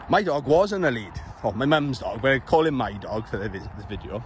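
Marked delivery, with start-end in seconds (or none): none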